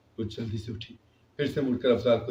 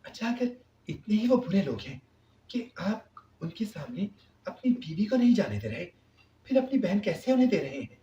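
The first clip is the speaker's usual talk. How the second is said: angry retort; 8 semitones higher than usual. A narrator's reading. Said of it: "Rabia نے غصے میں کہا".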